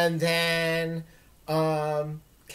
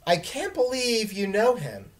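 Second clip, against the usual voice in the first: higher, eager gushing; enthused; 6 semitones higher, 3 dB louder than usual. Acted. Dylan gushes to his sister, enthusiastic. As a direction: bubble over with admiration. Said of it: "Dylan enthused".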